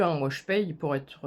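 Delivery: ordinary talk, nothing marked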